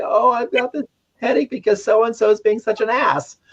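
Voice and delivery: high-pitched put-on griping; mock complaining. Calling somebody to complain about a headache, mock complaining. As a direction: complain to a friend, pitched well up. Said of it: "call somebody and complain and say".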